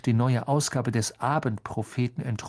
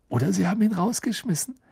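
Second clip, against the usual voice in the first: quick, eager and higher; enthusiastic; 8 semitones higher, faster than usual. Acted. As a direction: blurt it out gleefully. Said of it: "meinte Ron begeistert".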